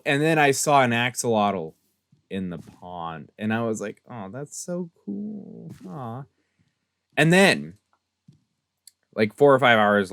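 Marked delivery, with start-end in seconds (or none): 4.1-6.2 hushed and slow, cooing over something cute; soft delighted aw. Retold or imitated. To the axolotl in the pond, a hushed slow delighted coo. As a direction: melt a little at the sight.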